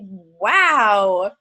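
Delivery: ordinary delivery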